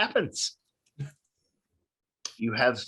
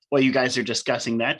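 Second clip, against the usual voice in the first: usual speaking voice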